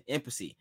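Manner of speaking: impasse said as IM-puh-see; mispronounced impasse. Shown as a demonstration. Host repeats his old mistake, impasse misread as IM-puh-see. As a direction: say impasse in three syllables, ending in "see". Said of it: "I pronounced it impasse".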